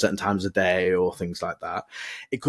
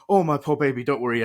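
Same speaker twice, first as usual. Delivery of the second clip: fussing dog owner; 6 semitones higher than usual. Retold or imitated. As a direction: coo over the dog like a frantic owner. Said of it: "But you're not going".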